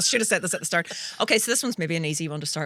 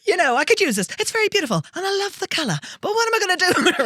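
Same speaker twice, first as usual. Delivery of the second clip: theatrical movie quote; 11 semitones higher, 6 dB louder, faster than usual. Acted. Quoting a film line.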